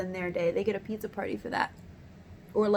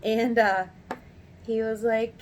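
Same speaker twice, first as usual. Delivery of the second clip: ordinary talk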